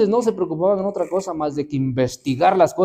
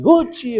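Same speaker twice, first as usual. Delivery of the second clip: high, loud call to chase off the pigs; shooing call; 11 semitones higher, 6 dB louder than usual. Retold or imitated. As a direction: shoo the pigs away with a sharp call.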